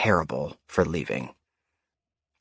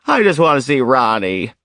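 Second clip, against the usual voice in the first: mock shout, louder and higher; retold shouting; 5 semitones higher, 6 dB louder than usual. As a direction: imitate her insistent yelling. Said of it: "while shouting".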